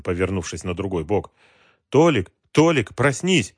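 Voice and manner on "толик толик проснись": urgent call. A narrator's reading. Wife wakes husband urgently.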